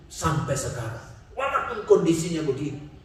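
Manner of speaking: ordinary talk, nothing marked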